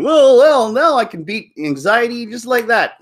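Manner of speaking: high, loud burst of sudden confidence; mock inspired moviegoer. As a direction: parody a girl suddenly cured by a film. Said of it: "and then come out going".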